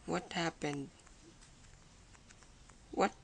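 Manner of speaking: ordinary talk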